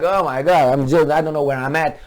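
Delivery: a dazed boxer, babbling and fast; mock dazed fighter. Mocking the fighter who threw one punch, dazed and fast. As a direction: play the punch-drunk guy lost in the ring. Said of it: "he's like".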